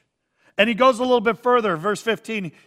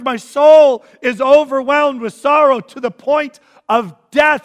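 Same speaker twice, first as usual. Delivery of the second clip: anguished Jesus; 5 semitones higher, 10 dB louder than usual. Acted. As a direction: voice Jesus overwhelmed, near breaking. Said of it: "Jesus even tells that to his disciples".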